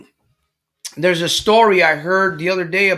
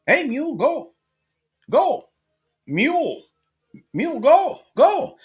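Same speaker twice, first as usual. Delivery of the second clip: high-pitched acted commands to an animal; calling to a mule; 11 semitones higher than usual. New owner urging a stubborn mule to move, calling out high. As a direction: call out short orders in a much higher voice.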